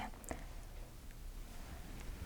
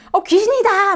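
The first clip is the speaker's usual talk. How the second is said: 귀신이다 cried out much higher in mock fright; scared cry; 9 semitones higher than usual. Frightened disciples on the boat shout out in mock fear. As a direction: play a startled disciple spotting a ghost.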